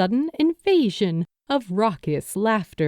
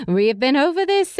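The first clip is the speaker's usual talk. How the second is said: patient mother voice; 6 semitones higher, 3 dB louder than usual. A narrator's reading. Character voice.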